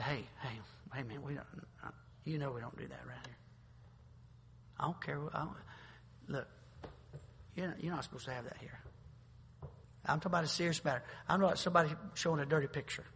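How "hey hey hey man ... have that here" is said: much softer, fast confidential warning; quiet private word. Retold or imitated. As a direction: lean in and keep it low. Acting a quiet word with a peer.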